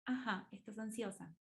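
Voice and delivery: soft, accepting acknowledgement; quiet, gentle therapist voice; empathic murmur. Shown as a demonstration. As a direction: say it softly and leave room for silence. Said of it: "Es solamente".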